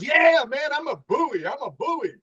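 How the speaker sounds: high-pitched, eager mock exclamation; excited agreement. Man understood as a buoy, excitedly agreeing. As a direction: burst in high, proudly confirming it.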